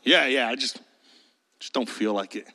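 very low, sheepish boss; reluctant boss. Acted. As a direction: trail off quietly with a half-made excuse. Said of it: "And the boss goes".

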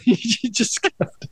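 ordinary delivery

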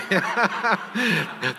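a short, real laugh at her own tears; embarrassed laugh. Real, not acted. Speaker laughing at herself for crying so soon, embarrassed laugh. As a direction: laugh it off lightly, a bit self-conscious.

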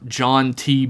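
ordinary delivery, nothing marked

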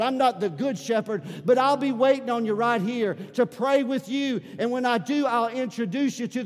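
loud pleading preaching; fervent altar call. Acted. Pastor inviting the unsaved to come forward, loud and urgent.

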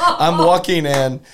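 laughing slowly through the words. Real, not acted. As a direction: giggle while saying it.

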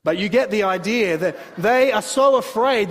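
normal talking voice

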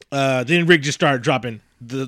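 normal talking voice

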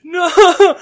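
hysterical wail, breaking up high and loud; retold crying. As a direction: sob it out like a kid who just lost his pet. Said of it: "crying my".

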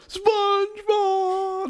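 high, long drawn-out mock cry; imitating Patrick's cry. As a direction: stretch the name out high, like someone being carried off. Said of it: "he's all like".

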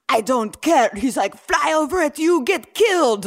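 high, loud villain voice; hammed-up Soviet minister barking orders; cartoon Russian villain. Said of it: "talking like that".

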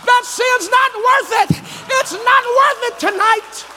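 high-pitched, impassioned pulpit shout; full-force preaching. Real, not acted. Preacher warning people falling over the cliff, full-force preaching. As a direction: hammer each refrain home to the congregation.

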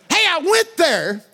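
high, loud, slow exclaim; excited shout. Real, not acted. Preacher launching into his Ireland story, excited and loud. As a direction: call it out with delight.

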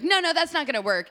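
high, brisk mock brush-off; imitating the producer shutting her down; dismissive producer. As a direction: wave her off like a know-it-all.

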